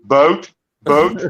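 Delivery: "Boat" said with a Canadian accent; Canadian-accented "Boat", each one set apart. Shown as a demonstration. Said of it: "keeps reminding me of my accent".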